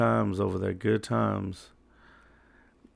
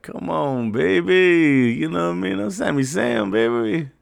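mock swagger; 8 semitones higher, 6 dB louder than usual.

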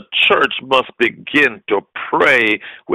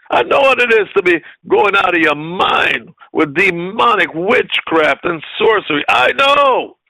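usual speaking voice

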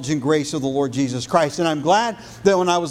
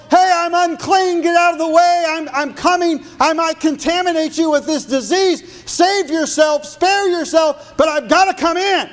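yelled warning; 11 semitones higher, 4 dB louder than usual. Acted. Preacher acting the leper warning people away, high yell. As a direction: cry out like an outcast warning the crowd. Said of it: "If I can yell".

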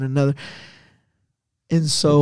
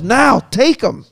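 slow, raised-voice order, higher pitch; yelled command; 8 semitones higher, 5 dB louder, slower than usual. Retold or imitated. Stranger shouting to ambulance crew, slow loud command. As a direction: bark it out slowly, a notch up. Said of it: "doors and yells".

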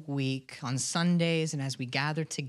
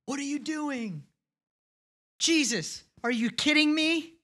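incredulous, much higher pitched outburst; upset disbelief; 10 semitones higher than usual. Retold or imitated. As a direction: play the outraged disciple, voice jumping up. Disciples upset at Mary's worship.

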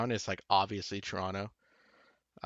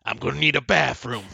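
louder impression of a TV character; Mother's Milk; 3 semitones higher, 7 dB louder than usual. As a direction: punch it out in a put-on character voice. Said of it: "That's my mother's note".

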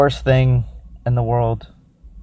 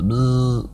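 buzzing "bzzz" through the lips; playful buzzing noise, held briefly; imitated buzz. Drink reviewer acting out the coffee's little buzz, buzzing noise.

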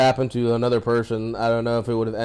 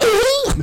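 high, loud imitated gasp of wind knocked out; hammed-up winded cry; winded groan; 5 dB louder than usual. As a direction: mimic a man slammed to the ground. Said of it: "Wind went out of him".

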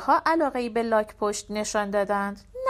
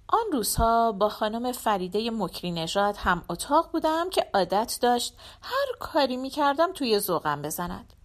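ordinary delivery

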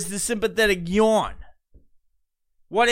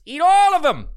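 high, loud yell at the dancers; shouted insult; 10 semitones higher, 6 dB louder than usual. Real, not acted. Podcaster yelling at TikTok dancers, loud and high. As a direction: blast it out, fed up.

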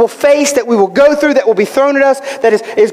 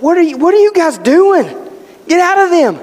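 higher-pitched imagined rebuke; mock confrontation; 6 semitones higher than usual. Preacher imagining Jesus challenging the demons, mock rebuke.

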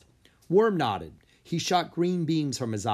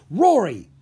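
loud, sharp name call; sharp interrupting call; 9 semitones higher, 11 dB louder than usual. Acted. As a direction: cut her off sharply. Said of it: "Griffith interrupted her".

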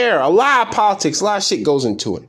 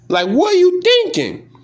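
exasperated disbelief; 12 semitones higher than usual. Real, not acted. Ranting about a minor leaguer's tweets, exasperated high question. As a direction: pitch jumps much higher in exasperation.

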